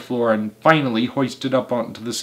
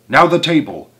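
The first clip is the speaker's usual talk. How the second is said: raised-voice command; shouting; 5 dB louder than usual. A narrator's reading. Mugglewump orders the big table moved, shouting. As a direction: call out the next job. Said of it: "shouted Mugglewump".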